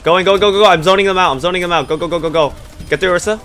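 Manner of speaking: loud hyped callouts; hyped gamer bro. Real, not acted. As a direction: shout the team forward, pumped. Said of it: "team push shouting callouts".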